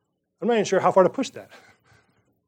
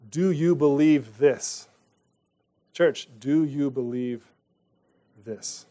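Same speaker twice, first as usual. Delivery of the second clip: slow earnest challenge; 4 semitones lower, 3 dB quieter, slower than usual. Acted. Preacher challenges the congregation.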